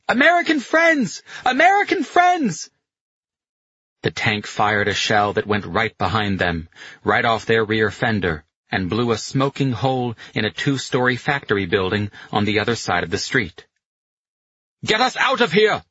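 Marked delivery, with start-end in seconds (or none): 0.1-2.7 loud, very high repeated shout; desperate yell. A narrator's reading. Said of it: "and yelled in English". 14.8-15.8 high shouted order; urgent bellow. A narrator's reading. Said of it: "Lyers bellowed".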